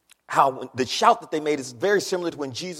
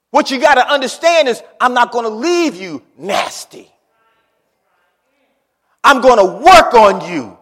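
loud, ringing sermon delivery, much louder; preaching at full force; 7 semitones higher, 14 dB louder than usual. Acted. Preacher rouses congregation about God's work, full-force preaching. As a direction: preach it out to the whole room.

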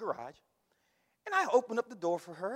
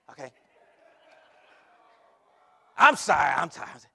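ordinary talk

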